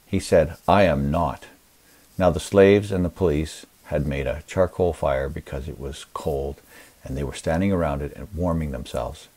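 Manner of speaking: normal talking voice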